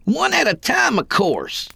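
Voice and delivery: high-pitched indignant bellow. A narrator's reading. Kid answering a fool's question, bellowed. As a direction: bellow it high, then drop on the last words. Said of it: "he bellowed".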